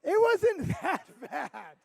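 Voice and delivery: slow, loud, laughing through it. Acted. Leader correcting himself about the motel, slow laughing. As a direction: drag it out while cracking up.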